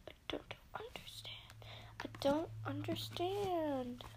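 high faint whisper. Pretend. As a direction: whisper it, lost. Repeating line in whisper.

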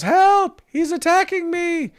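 high, shrill-free panicked cry of a woman; hammed-up victim crying out; retelling a video victim's cry; mock yell for help. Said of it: "she yells".

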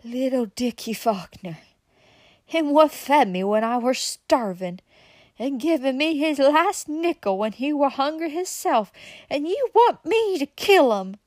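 rising from musing to outraged grief; building anguish. A narrator's reading. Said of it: "as though talking to himself".

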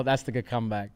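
ordinary talk

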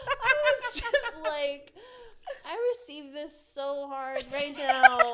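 choked up, loud and tearful; moved to tears while retelling; half laughing, half crying; teary, laughing. Real, not acted.

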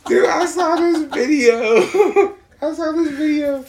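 very high, loud, breaking up with laughter; helpless laughing squeal; laughing hard. Real, not acted. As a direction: struggle to get it out through laughter. Cracking up over the retching.

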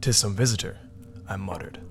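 ordinary delivery

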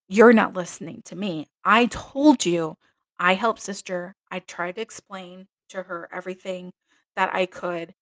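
mild mock yell, not full force; retold screaming. Nun yelling back at her superior, a mild mock yell. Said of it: "I just started screaming at her".

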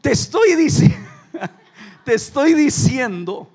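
high, loud, words through real laughter; laughing hard. Real, not acted. Preacher cracking up at his own joke, loud and laughing. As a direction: laugh while you get the words out.

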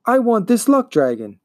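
high-pitched, urgent demand; insistent young hero. Acted. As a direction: stand up to the monster and demand it. Atreyu demands the dragon from monster.

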